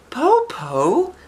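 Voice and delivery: put-on squeaky child calling through a door; high small voice, wary; high little girl voice. Eldest daughter asks who knocks, in a high child voice.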